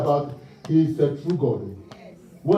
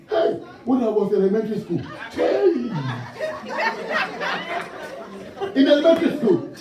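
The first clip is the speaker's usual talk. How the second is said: high, fast, laughing through the story; 6 semitones higher, faster than usual. Real, not acted. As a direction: tell it fast, cracking up. Comic childhood story, laughing.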